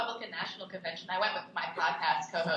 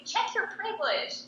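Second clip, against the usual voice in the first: much higher, slow mocking whine; mocking her; 11 semitones higher than usual. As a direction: parody the protester's voice, high and drawn out. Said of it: "she told him to check his privilege".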